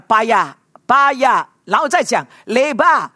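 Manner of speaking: 巴呀 said slowly on its own, then 嘞吧 separately; broken into parts. Shown as a demonstration. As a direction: split 巴呀 and 嘞吧 into slow chunks. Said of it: "你要讲".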